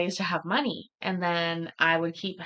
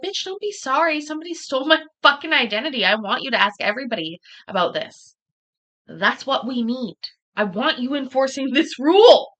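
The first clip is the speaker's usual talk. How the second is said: mock rant at teller; 6 semitones higher, 6 dB louder than usual. Retold rant at apologetic teller.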